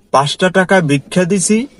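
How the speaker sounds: ordinary talk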